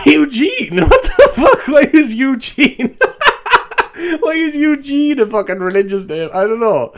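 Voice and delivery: high, cracking with laughter; laughing disbelief. Real, not acted. Laughing at his own choice of Eugene as a monk's name. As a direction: laugh through the question in disbelief.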